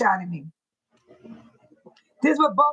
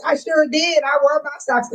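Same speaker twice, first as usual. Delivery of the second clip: ordinary talk, nothing marked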